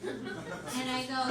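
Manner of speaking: ordinary delivery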